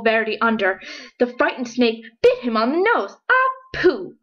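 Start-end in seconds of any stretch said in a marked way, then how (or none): 3.3-4.2 yelp of pain. Acted. Fish bitten on the nose.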